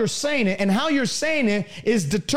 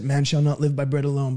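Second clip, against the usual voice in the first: low monotone recitation, no conviction; mock a meek believer reciting a verse; timid scripture quoting; 9 semitones lower than usual. Shown as a demonstration. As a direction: drop low and drone it weakly. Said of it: "That's not how Jesus said".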